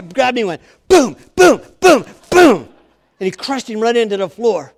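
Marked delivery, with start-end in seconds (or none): 0.9-2.7 very loud, high booms; explosive, separate impact noises; imitated smashing. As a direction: punch out each boom like a body slam. Acting out the Hulk's slams.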